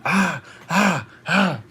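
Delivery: high mimicked cries; spaced-out high mock yells; imitated shouting. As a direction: mimic a crazy man shouting wordlessly. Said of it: "he's shouting".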